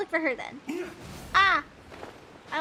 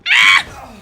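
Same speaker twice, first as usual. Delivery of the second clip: sudden high shriek; loud high-pitched yelp; high startled scream; 15 dB louder than usual. Retold or imitated. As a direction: yelp like the character did. Imitating character's noise.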